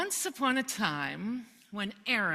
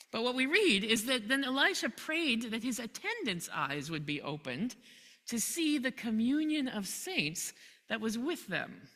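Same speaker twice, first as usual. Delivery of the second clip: ordinary delivery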